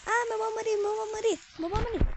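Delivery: panicky. Real, not acted. Monsters chase her in game.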